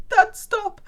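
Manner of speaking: high, groaning plea at dad; embarrassed whine of "stop"; exasperated. Retold or imitated. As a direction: beg dad to drop the subject. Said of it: "and then I'm like".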